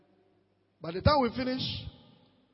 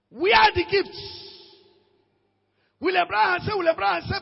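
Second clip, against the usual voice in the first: confronting demand; 7 semitones higher, 4 dB louder than usual. Retold or imitated. Confronting his pastor.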